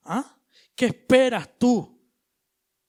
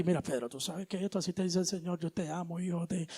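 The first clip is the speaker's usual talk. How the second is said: much softer, gentle and unhurried; Christ's calm comfort; 4 semitones lower, 11 dB quieter, faster than usual. Retold or imitated. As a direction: reassure Pedro tenderly amid the storm. Christ calmly comforting Pedro. (Cristo consuela a Pedro con voz baja y tierna)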